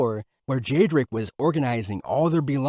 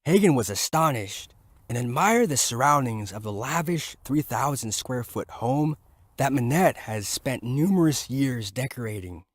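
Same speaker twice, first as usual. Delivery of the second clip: plain reading voice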